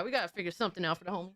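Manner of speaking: ordinary talk, nothing marked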